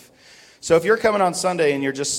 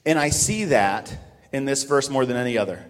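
ordinary delivery

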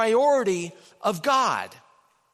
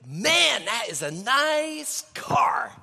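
higher, slow and savoring; admiring thought; 5 semitones higher, 3 dB louder, much slower than usual. Retold or imitated. Pastor voicing his own thought about the car, slow admiring. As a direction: drool over the car a little. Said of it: "I think".

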